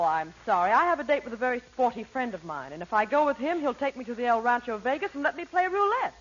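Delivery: ordinary delivery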